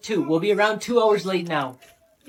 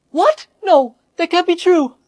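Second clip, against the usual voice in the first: much higher, louder cry of disbelief; shocked disbelief; 8 semitones higher, 7 dB louder than usual. Acted. Patient to doctor, panicked disbelief. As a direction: blurt it out in panic. Patient hears he'll die.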